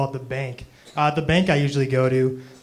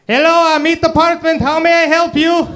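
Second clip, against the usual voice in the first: much higher, loud, slow put-on falsetto; fake phone voice; 13 semitones higher, 9 dB louder than usual. Clerk pranking the yogurt caller on the phone, squeaky falsetto.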